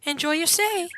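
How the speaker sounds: high, sing-song host; welcoming innkeeper. Acted. Innkeeper sends a guest to bed, high lilting welcome. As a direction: chirp it high like a polite innkeeper.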